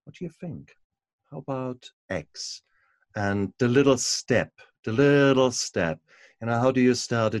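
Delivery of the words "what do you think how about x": softly, higher, a mock whisper of a guiding voice; gentle whisper in the ear. Host imagining a guide whispering advice to a tired woman, soft and higher. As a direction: coax like a voice murmuring advice into someone's ear. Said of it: "someone to actually just whisper in your ear".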